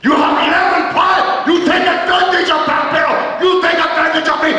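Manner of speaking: high furious shouting wrestler; angry accusation. Retold or imitated. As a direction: yell the accusation at the kid.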